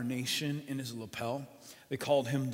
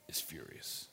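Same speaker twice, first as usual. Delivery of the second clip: whispered; 11 semitones lower, 11 dB quieter than usual. Acted. Whispered word.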